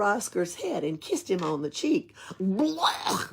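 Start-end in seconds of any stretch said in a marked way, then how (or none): 2.4-3.3 disgusted yuck. Acted. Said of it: "said Oscar".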